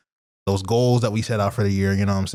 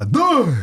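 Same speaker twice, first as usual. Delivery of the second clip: loud, high cartoon "duh"; SpongeBob sound imitation; 5 dB louder than usual. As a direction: blurt out a high, goofy cartoon noise. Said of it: "is that how the sound goes from SpongeBob".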